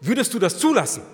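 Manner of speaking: loud, high, demanding question; forceful challenge. Real, not acted. Preacher demands an answer from congregation, forceful challenge. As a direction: throw the question out at full force.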